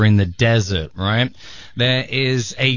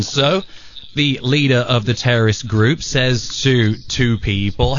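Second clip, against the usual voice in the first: usual speaking voice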